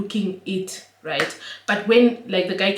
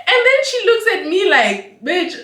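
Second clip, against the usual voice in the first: laughing; 12 semitones higher, 8 dB louder than usual. Real, not acted. Sister's retort, laughing.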